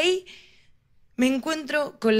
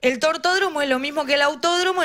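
usual speaking voice

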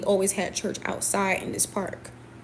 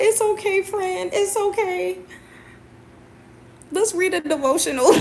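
imitated crying; 9 semitones higher than usual. Crying friend comforts another crying friend, hammed-up tearful. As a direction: act out a crybaby consoling a friend, pitched way up. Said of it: "two big crybabies".

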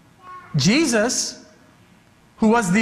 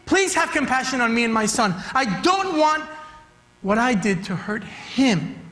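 pleading father; 5 semitones higher than usual. Acted. Father voiced pleading to Jesus.

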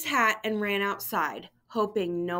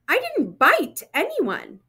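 higher and louder, offended; indignant protest; 9 semitones higher, 6 dB louder than usual. Acted. Simon protesting to Grandma Rose, high indignant cry. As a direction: push back, upset at the idea.

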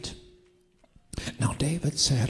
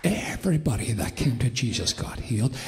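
full-force preaching; 4 dB louder than usual. Real, not acted. Preacher declaring healing to the church, bold and forceful. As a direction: proclaim it boldly, stretching key words.

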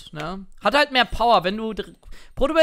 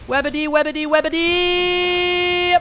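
high, stretched call, the last Webedy dragged out very long; 10 semitones higher, 4 dB louder, slower than usual. Real, not acted. Streamer calling his teammate Webedy for help, high drawn-out call. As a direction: shout the name across the map, pulling the last one long.

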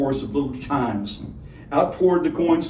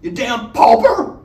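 sodden snarl; 10 semitones higher, 7 dB louder than usual. A narrator's reading. Honeybell snarling at Matthew, furious and tearful. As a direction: spit it at him through tears. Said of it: "a sodden snarl".

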